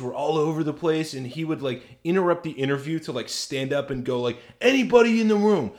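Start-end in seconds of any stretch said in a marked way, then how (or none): 4.6-5.7 high, loud druggy rant; Florida Man. Retold or imitated. Imitating a interviewee calling out to the room, druggy voice. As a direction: holler it like a wired druggie interrupting. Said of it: "he spoke kinda like this".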